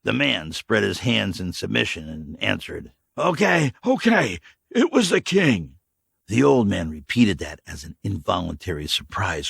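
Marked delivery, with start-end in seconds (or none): 3.2-5.8 high, loud, giving in; cornered drifter. Acted. Stranger caving under the rebar, hurried. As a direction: give up the name in a hurry. Dirty man's voice.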